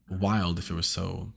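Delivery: normal talking voice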